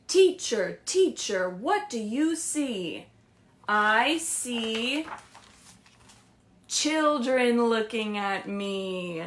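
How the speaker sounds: slow, singsong picture book read; lilting read-aloud to little kids; reading to children. Acted. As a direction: read it like story time.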